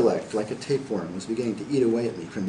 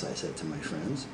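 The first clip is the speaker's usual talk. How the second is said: plain reading voice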